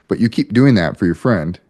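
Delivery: normal talking voice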